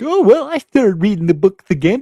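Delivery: high, loud mock fan voice. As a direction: put on a squeaky fan voice to ridicule them. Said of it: "all twelve of them were like".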